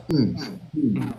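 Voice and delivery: short "hmm, hmm" backchannel; listening hum. Shown as a demonstration. Speaker showing the Japanese listening habit, small attentive hums. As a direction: hum along to show you're listening. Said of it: "to just go".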